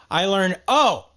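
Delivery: ordinary talk, nothing marked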